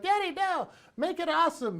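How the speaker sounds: put-on high, whiny child voice; protesting little kids. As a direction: complain high and loud like a kid. Kids protesting dad's dinosaur.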